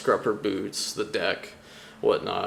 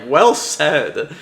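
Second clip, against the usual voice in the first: loud approval; 5 semitones higher, 10 dB louder than usual. Retold or imitated. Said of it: "Luffy's all like".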